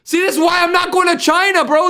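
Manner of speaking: high, insistent outburst; high-pitched exasperated exclamation; exclaiming shout. Real, not acted. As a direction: shout it as proof of your point. Exclaims at the China clip.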